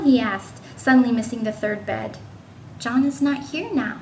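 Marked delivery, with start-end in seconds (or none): none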